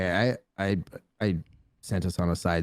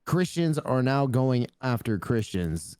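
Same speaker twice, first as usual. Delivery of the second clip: ordinary talk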